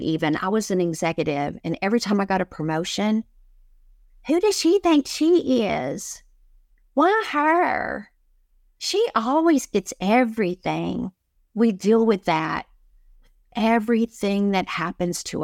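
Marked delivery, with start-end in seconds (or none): 4.3-11.1 high, sneering gossip voice, slower on the first line; mock the catty colleagues who resent her; jealous coworkers. Gossiping coworkers after her promotion.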